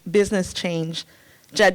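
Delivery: usual speaking voice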